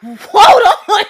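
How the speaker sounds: high, loud excited exclamation; laughing shocked gasp of delight; stunned hold on. Retold or imitated. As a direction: stop short, dazzled. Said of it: "I said".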